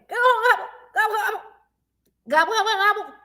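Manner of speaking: high, quick gobble gobble calls; high turkey gobbling for the kids. Shown as a demonstration. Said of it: "What kind of noise does a turkey make?".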